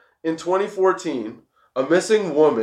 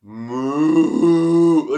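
long, drawn-out mock moo; imitated cow moo; 2 semitones higher, 3 dB louder than usual. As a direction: moo like a person who believes they're a cow. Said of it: "they go".

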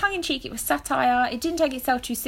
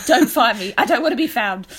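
laughing; 5 semitones higher, 9 dB louder than usual. Real, not acted. Laughing panic about phone.

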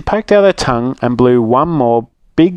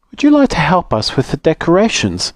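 lightly voiced polite rabbit, a bit higher; Missus Rabbit; 6 semitones higher, faster than usual. Missus Rabbit asking Little Rude to help, polite and light. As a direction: sweetly ask the rude girl to help.

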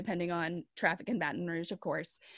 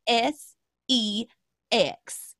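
put-on a light sweet Southern accent, spelling out S E X; prim Southern drawl on each letter, a touch louder; 6 dB louder, much slower than usual. Prim church lady tells the class about sex, light sweet Southern accent. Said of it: "she's got that very sweet Southern little accent".

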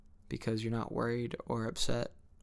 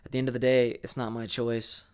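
ordinary talk

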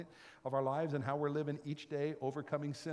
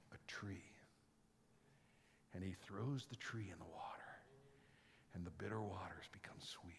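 whispered miracle; soft whispered storytelling; 6 semitones lower, 12 dB quieter than usual. Real, not acted. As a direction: hush it like a secret wonder. Hushed telling of the miracle.

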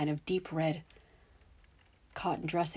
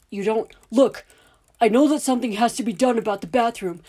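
loud, higher, heated insistence; angry shouting; 5 semitones higher, 11 dB louder than usual. Acted. Father shouting back while interrupting the official. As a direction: hammer the point home, shouting. The men pushing, Dudley cutting in.

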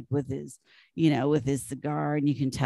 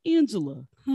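light East Coast "Angela", said higher; chirpy East Coast greeting "Angela"; East Coast accent; 6 semitones higher than usual. Retold or imitated. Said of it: "and he's like".

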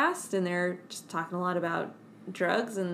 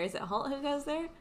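ordinary delivery

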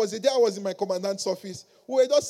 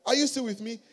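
ordinary talk

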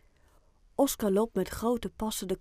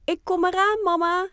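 put-on a child shouting back from his room, much higher and louder; boy calling back; 11 semitones higher, 8 dB louder than usual. Oscar calls down to his mother from his bedroom, high loud calling voice. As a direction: answer mum from upstairs. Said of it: "roept Oscar vanuit zijn slaapkamer".

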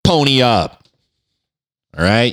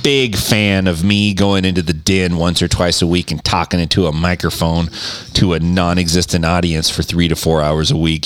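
usual speaking voice

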